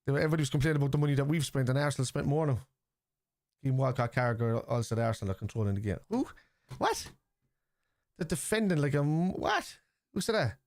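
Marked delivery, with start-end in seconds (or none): 6.1-10.6 high-pitched disbelieving outburst; incredulous. Real, not acted. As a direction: react in disbelief, pitch leaping up. Incredulous reaction to pundits' claim.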